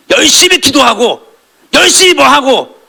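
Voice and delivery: high, slow mocking voice of a show-off believer; sneering imitation of religious busywork; mocking zealot. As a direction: lampoon the proud zealot, stretched out. Mocking showy religious zeal.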